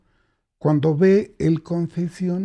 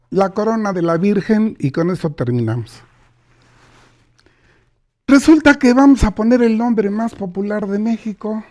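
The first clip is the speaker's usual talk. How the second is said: ordinary talk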